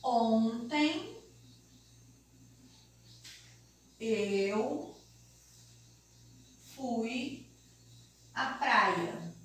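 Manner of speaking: long pauses between each word; slow word-by-word dictation. Shown as a demonstration. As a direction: dictate it one word at a time. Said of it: "se eu coloco assim". (ditado pausado, palavra por palavra)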